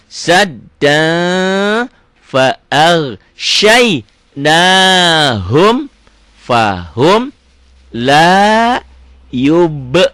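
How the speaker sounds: slow, drawn-out chanted recitation; Quran recitation. Shown as a demonstration. Teacher recites the verse for beginner students, slow and chanted. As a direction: chant each word slowly and loud.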